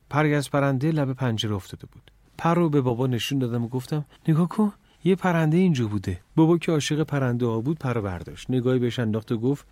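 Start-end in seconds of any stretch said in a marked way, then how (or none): 4.3-6.2 excited. A narrator's reading. Boy shows feather to father.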